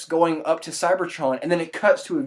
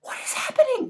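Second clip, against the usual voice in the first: much higher, squeaky disbelief; baffled shock; 13 semitones higher, faster than usual. Retold or imitated. Viewer seeing the post-credit scene, stunned and high. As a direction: gasp it out in total confusion. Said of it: "I'm like".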